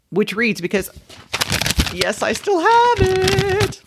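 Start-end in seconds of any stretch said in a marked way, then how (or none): 1.9-3.8 slow squeal of admission; high, drawn-out aside. Real, not acted. Host admitting she kept the note, high squealed aside. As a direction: stretch it out way up high.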